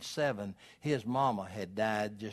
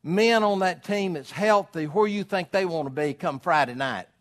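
ordinary talk, nothing marked

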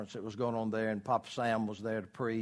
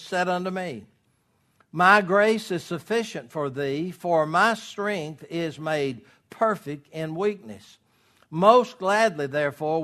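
loud, declamatory pulpit reading; scripture reading; 3 semitones higher, 6 dB louder, slower than usual. Real, not acted. Preacher reads Paul's verses to the congregation, emphatic proclamation. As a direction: proclaim each phrase, slowing into the final line.

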